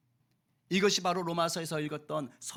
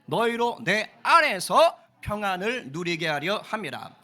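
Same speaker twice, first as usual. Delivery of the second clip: loud group recitation; 8 dB louder than usual. Real, not acted. Pastor leading the congregation in scripture, loud recitation. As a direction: read scripture aloud, louder, in unison cadence. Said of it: "우리 큰 소리로 시작".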